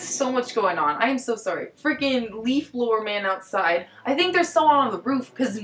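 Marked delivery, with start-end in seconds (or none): none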